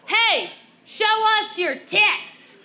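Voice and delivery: high, loud, drawn-out mock catcall; imitated yell. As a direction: yell it out like a rowdy guy calling across a river. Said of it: "one of them yells out".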